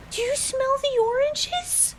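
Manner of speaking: very high-pitched gushing question; mock overexcited woman. Woman elbowing the kid next to her, mock overexcitement. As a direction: imitate an awestruck stranger's squeal. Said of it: "this woman sitting next to me elbows me".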